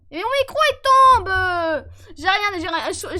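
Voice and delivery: loud, slow exasperated wail; slow frustrated shout. Real, not acted. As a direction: drag out the complaint loudly. Mic falls again.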